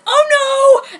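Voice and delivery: high, loud cry of grief; hammed-up cry of despair; anguished cry. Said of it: "and John said".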